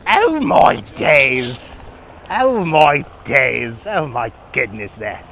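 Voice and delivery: slow, drawn-out British exclamations; exaggerated put-on British accent; mock British accent. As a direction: stretch it into hammed-up British.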